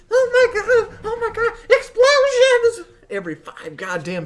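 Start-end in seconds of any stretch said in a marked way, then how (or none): 0.1-2.9 high, loud, laughing mock panic; mocking hyper kid. Gamer mocking distracted players, squealing hammed-up panic. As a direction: ham up an overexcited kid yelling about explosions. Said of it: "this A D H D Ritalin induced".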